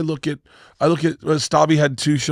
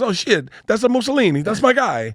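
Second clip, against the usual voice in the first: high mock Italian guy; 8 semitones higher than usual. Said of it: "And he goes".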